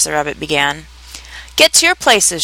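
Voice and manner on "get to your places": high, restrained shout of command; thundering. A narrator's reading. The Queen sending players to their places, mildly thundering. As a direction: order them sharply with pitch raised. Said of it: "in a voice of thunder".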